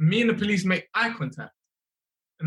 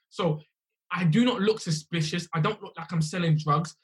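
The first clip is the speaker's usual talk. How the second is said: ordinary talk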